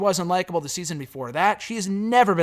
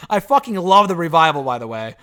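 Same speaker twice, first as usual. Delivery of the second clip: laughing; 5 dB louder than usual. Real, not acted. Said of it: "laughing while gushing".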